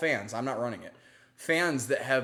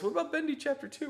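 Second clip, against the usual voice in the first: fan asking; faster than usual. Retold or imitated. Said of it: "when people ask us".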